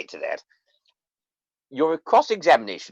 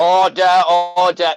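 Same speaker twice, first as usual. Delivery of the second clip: calling order; 3 semitones higher, 8 dB louder than usual. Acted. Judge calls court to order.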